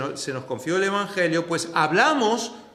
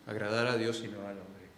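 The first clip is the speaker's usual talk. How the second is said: quiet, lower repeat of the line; soft echo; 8 semitones lower, 8 dB quieter than usual. Acted. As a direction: let agradar a Dios y no al hombre fall away softly. Preacher echoes the point softer.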